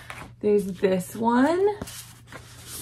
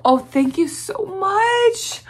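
gushing thanks; 10 semitones higher, slower than usual. Real, not acted. Thanking the gift giver.

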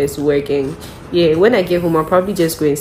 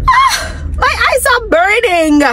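pained cry; slower than usual. Real, not acted. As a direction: yelp out as your eyes sting. Eyes stinging after doing lashes.

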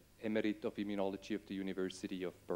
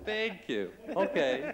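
amused thanks; 9 semitones higher, 7 dB louder, slower than usual. Real, not acted. Laughing after the joke.

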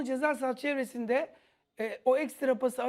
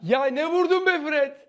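amazed, admiring outcry; delighted exclamation; 4 semitones higher, 6 dB louder than usual. Real, not acted. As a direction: marvel at the shot. Co-commentator addresses scorer. (hayranlıkla sitem)

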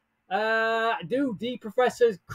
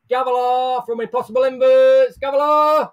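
hype introduction; 3 semitones higher, 7 dB louder than usual. Real, not acted. Introducing co-host with fanfare.

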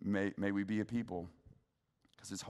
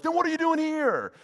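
much higher, loud incredulous yell; mock outraged disciple; 11 dB louder than usual. A disciple scolds Peter and John, mock shouting. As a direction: blurt it out as a scandalized disciple. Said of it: "there's at least one person that was like".

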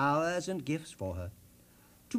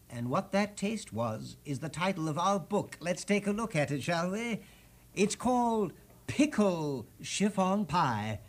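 ordinary delivery, nothing marked

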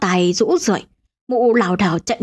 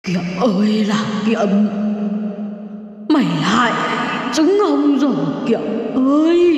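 wailing lament. A narrator's reading. Dead father wailing at his son for ruining him, tearful lament. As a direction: wail out the name in reproach. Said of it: "Vòng còn lại cũng khóc lóc".

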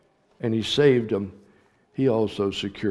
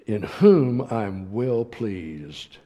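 very slow, deliberate; slow divine words; 2 semitones lower, much slower than usual. Acted. Preacher voicing the Father's approval of his Son, very slow. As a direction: let each word hang in the air. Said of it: "And the Father says".

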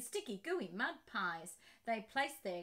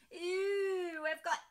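complaining; 5 semitones higher, 4 dB louder than usual. Acted. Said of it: "complained Ben".

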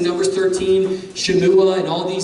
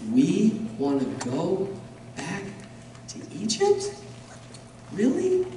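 mock them in disbelief; incredulous mockery; 8 dB quieter than usual. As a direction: want to go back to Egypt, really?. Mocking the people's request.

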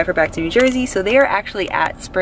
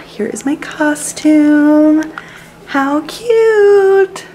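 higher and louder, slow and beaming; excited gushing; 8 semitones higher, 5 dB louder, much slower than usual. Real, not acted. Vlogger showing her costume to viewers, excited and higher. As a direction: show off the costume with delight.

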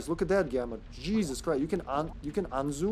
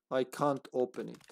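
ordinary talk

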